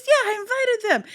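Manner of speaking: put-on a small child boasting happily; proud little girl. The inner child explaining her new friends, high and proud. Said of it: "She goes".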